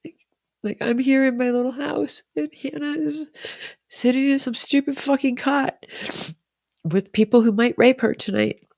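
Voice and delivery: high-pitched, real tearful voice; choked-up and high, pushing on through crying; tearful blubbering. Real, not acted. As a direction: talk through tears, voice lifted high. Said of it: "blubbering on".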